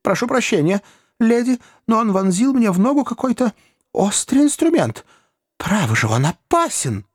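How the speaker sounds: protest, still stung; shaken Pickwick. A narrator's reading. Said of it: "мистер Пиквик". (взволнованная жалоба, намного выше)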